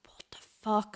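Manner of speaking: breathy whispered shock, barely voiced; inner whisper. Comic recalling her stunned private reaction, whispered. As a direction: whisper it like a thought you can't say aloud. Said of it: "in my head".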